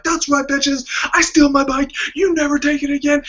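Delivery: high, loud triumphant hollering; victory yell. Retold or imitated. Man celebrating to the crowd after smashing the lock, triumphant yell. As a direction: crow it like you just won a game show.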